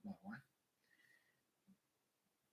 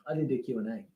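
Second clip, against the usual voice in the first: softer, lower, muttered to himself; quiet repeat; 3 semitones lower, 7 dB quieter, much faster than usual. Real, not acted. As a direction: say it again under your breath.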